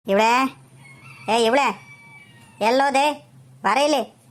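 short calls with rising ends; calling out. Acted. Older woman helping find the missing mother-in-law, calling out. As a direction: summon someone who is out of sight. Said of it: "ತಡಿ ಕೂಗಣ".

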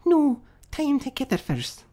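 very high little character voice; second workman. Acted. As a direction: correct your mates in a high pipe. Another workman corrects the others.

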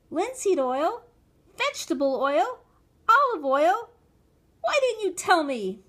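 high, loud indignant protest; shrill, cross child's complaint; angry child. A narrator's reading. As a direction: fling each option back accusingly. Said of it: "I said angrily".

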